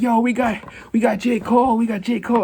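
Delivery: high, excited hype-man voice; hyping up the big guest arrival; hyped DJ. Retold or imitated. DJ reenacted for viewers, high and excited. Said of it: "I have to reenact it for you".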